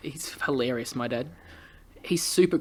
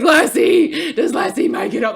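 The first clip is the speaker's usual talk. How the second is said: high, loud comic wail; mock bawling; 11 semitones higher, 6 dB louder, slower than usual. Viewer watching Lassie come home, mock bawling. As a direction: wail it in hammed-up distress. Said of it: "bawl my fucking eyes out".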